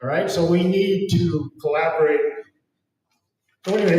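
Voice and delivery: usual speaking voice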